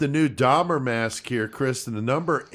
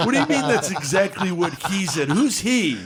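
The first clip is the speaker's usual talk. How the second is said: higher-pitched mock disbelief; incredulous; 7 semitones higher than usual. Friend questioning the mystery caller, incredulous. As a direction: demand an answer, thoroughly baffled. Acted incredulous demand on phone.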